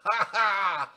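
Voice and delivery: loud, high "ha ha"; big hammed-up villainous laugh; theatrical laugh. Character's laugh after his boast.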